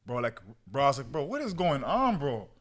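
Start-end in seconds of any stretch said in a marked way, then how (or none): 1.1-2.5 disbelief. Retold or imitated. News of mom's heart attack.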